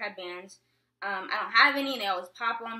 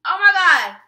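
much louder, higher cry of surprise; excited shout; 8 semitones higher, 17 dB louder than usual. Real, not acted. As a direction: burst out at the sight of them. Sees someone outside, calls out.

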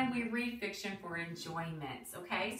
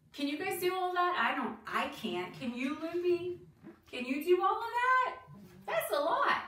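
high-pitched, incredulous questions; playful amazement; 9 semitones higher, 3 dB louder than usual. Real, not acted. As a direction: ask the little ones in high, amazed disbelief. Teasing listeners with playful amazed questions.

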